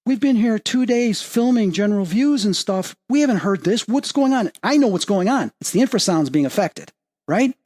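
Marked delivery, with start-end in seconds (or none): 0.1-4.5 higher-pitched imitation of a startled crew; retold freak-out, raised pitch but not shouted; mildly freaking out. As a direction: act out mild alarm, pitched up. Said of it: "crew starts freaking out going".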